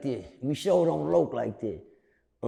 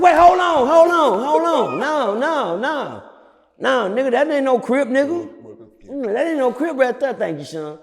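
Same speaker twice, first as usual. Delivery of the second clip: loud, high incredulous shouting; retold outburst at a fake Crip, loud and high; outraged yelling; 10 semitones higher, 5 dB louder than usual. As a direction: shout him down, in disbelief. Him yelling at the dancing inmate.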